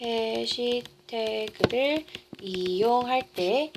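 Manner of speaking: soft, drawn-out write-along pace; slow dictation. Real, not acted. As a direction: draw it out while writing. Repeats slowly while writing it out.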